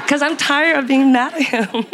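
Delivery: high, breathless laugh through words; laughing confession. Real, not acted. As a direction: laugh it out sheepishly.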